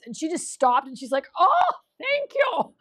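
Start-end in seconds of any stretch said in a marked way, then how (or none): 1.4-2.7 high, drawn-out delighted thanks; touched surprise. Retold or imitated. Little lady with a walker thanking a stranger, delighted. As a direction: coo it, overcome by an unexpected compliment.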